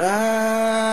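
long, high, loud held scream; hammed-up howl of sudden pain; doctor's scream. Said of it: "I heard the doc, he went".

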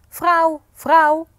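loud, high-pitched call for his wife. Acted. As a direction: holler for someone in another room. Menseneter roept zijn vrouw erbij. (luid roepend naar zijn vrouw)

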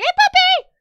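very high, loud, slowed puppy-calling voice; high-pitched doggy talk. Real, not acted.

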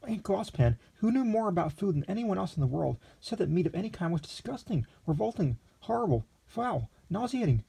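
put-on high, breathless young gourmet in raptures; a naive young man overwhelmed by a dish; eager young Lexington. A narrator's reading. Lexington marvelling at the meat, then calling the waiter, high.

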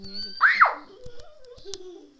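laughing shout. Real, not acted. Laughing shout while tickled.

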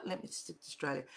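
soft mumbled self-correction; quiet repeat. Shown as a demonstration. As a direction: say it again under your breath.

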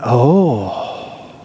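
low, soft, drawn-out imitated awe; awed little boy. Preacher quotes boy seeing the piranha, awed. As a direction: imitate a child's hushed wonder.

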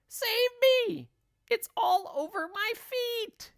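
very high, panicked shrieking; screamed. A narrator's reading. Wife feels it on her feet, screaming. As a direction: squeal for rescue in terror. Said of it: "screamed Missus Twit".

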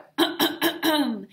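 a loud, exaggerated throat clear. A narrator's reading. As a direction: ahem to get the class's attention. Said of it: "as she cleared her throat".

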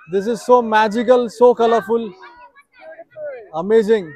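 delighted, laughing, loud and drawn out; real thrill at the colors; excited. Real, not acted. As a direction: gush over the view, stretching every word. Arriving at colorful Venice copy.

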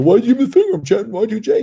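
squeaky offended fan voice; mock whiny fan. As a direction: mimic a baffled fan complaining. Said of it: "people always go".

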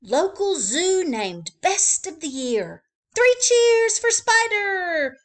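high, loud, slow cheering headline. Acted. Reading the proud headline, high and loud. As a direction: trumpet the headline. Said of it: "The headline read".

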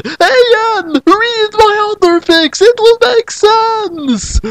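very high, slow, drawn-out screech; shrieked author's note. Acted. Author calls to Ian about other fics, high drawn-out shriek. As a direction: screech it slowly, stretching the last words.

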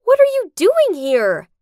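near scream. Acted. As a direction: cry it out in fright. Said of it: "I almost screamed".

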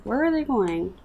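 ordinary talk

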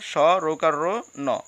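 স রৌকার র ন dictated slowly, part by part; slow spelling. Shown as a demonstration. Teacher dictating the spelling of স্মরণ to students slowly. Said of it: "স্মরণটা তোমরা কীভাবে লিখবা".

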